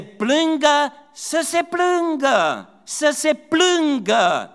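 very high, loud pulpit cry, slowing on the repeats; fiery preacher pleading with the congregation; preaching at full force. Acted. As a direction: drive the point home again and again. Preaching burst, raised voice.

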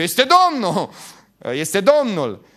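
loud, high excited shout. Retold or imitated. Disciple spotting Jesus from the boat, shouting. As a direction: cry it out as you spot the Lord. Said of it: "A început să strige".

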